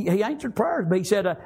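ordinary talk, nothing marked

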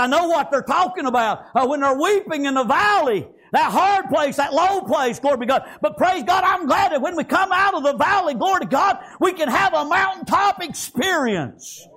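driving, rhythmic sermon voice; impassioned preaching. Real, not acted. Preacher leading congregation out of the valley, impassioned preaching. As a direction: climb phrase by phrase to a long-held finish.

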